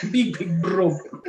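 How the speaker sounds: slow, heavy mock Russian; hammed-up Russian accent. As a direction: lay on a thick Russian accent, stretching the words. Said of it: "Russian accent".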